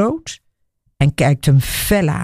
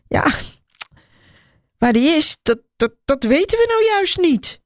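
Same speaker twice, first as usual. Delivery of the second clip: much higher, stammering squeak; 9 semitones higher than usual. A narrator's reading. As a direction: squeak it out nervously. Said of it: "piept ze".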